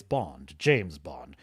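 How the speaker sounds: low, cool Bond introduction; Bond catchphrase. Retold or imitated. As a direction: drop into a smooth spy voice. Quoting the James Bond catchphrase.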